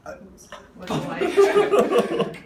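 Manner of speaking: slow, laughing apology. Real, not acted. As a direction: laugh through the oops. Patient gags during exam.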